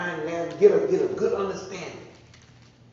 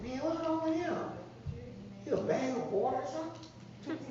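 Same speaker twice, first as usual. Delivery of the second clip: high, scoffing bystander voice; mock the crier from the side; mocking onlooker; 10 semitones higher, 8 dB quieter than usual. Mocking onlookers of a crying man.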